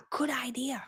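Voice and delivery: breathy whisper, meek; whispered agreement. Retold or imitated. Mom agreeing with her husband in a soft whisper. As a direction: murmur it to yourself.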